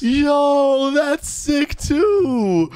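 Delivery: high, drawn-out wow; amazed excitement. Real, not acted. Gamer shown the lift for high buildings, amazed. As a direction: gush in a high, stretched voice.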